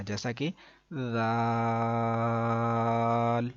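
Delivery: زال drawn out softly; soft zaal. Shown as a demonstration. Teacher shows the gentle reading, soft slow زال. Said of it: "نرمی سے پڑھیں گے تو زال کا".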